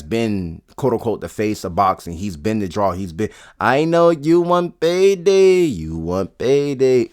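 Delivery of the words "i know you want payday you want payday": drawn-out, high-starting mimicry; Canelo. Boxer taunting a rival about payday, slow mocking impression. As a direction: imitate a boxer, stretching every word slowly. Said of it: "that's Canelo".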